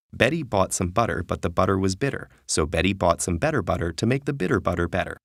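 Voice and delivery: rattled off fast; quick tongue twister. Shown as a demonstration. Narrator showing a tongue twister, rattled off fast. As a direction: rush through the twister without tripping. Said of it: "Great examples include tongue twisters".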